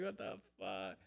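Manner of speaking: low mutter. Retold or imitated. Retold shock at steak.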